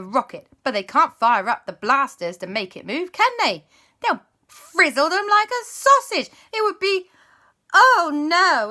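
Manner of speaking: high, loud dramatic narration; excited storytelling. A narrator's reading. Narrator to children, excited dramatic telling. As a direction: build the drama for the kids.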